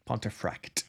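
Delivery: Pontefract said softly and carefully; careful pronunciation. Shown as a demonstration. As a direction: sound out "Pontefract" softly as an awkward word. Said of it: "it's an awkward word".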